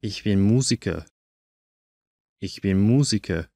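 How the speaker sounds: ordinary talk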